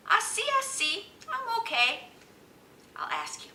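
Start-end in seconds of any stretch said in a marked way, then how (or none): 0.1-2.1 high-pitched, noncommittal reply; lifted, wavering so-so; acted so-so answer. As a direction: act out being just okay. Acts out feeling so-so.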